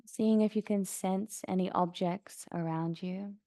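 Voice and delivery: soft, even meditation voice with long pauses; guided meditation. Acted. Yoga teacher guiding class to notice sounds around them, calm meditation voice. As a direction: settle them gently into stillness, unhurried.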